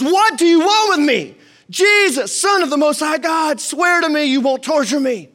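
loud, high shouting plea; demon shouting. Acted. Possessed man shouting at Jesus, loud and high. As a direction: cry out at the top of his voice. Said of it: "he shouted at the top of his voice".

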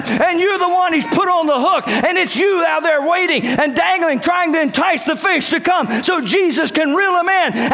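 high-pitched, ringing revival delivery; full-force preaching. Real, not acted. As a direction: preach at the peak of fervour. Preaching at its peak.

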